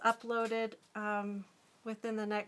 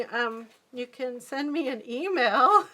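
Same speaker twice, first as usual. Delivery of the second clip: laughing; 2 semitones higher, 8 dB louder than usual. Real, not acted. Flustered by a kind viewer.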